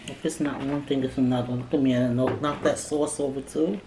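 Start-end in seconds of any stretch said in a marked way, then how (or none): none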